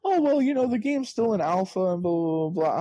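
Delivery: high, whiny brush-off voice; sneering imitation of the developer's excuse; mock developer excuse. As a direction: parody a lame excuse, way up high.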